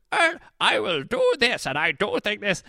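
put-on, exaggerated imitation of a narrator's character; Sable Keach impression. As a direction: ham up the audiobook character's odd voice. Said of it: "in the audiobook he goes".

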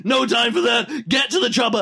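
hammed-up movie command over explosions; mock action hero. Hero cutting off thanks to flee, urgent shouting.

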